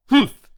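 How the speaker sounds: a loud, high, short humph; grumpy snort. Acted. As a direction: snort it out in disgust. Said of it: "snorted George".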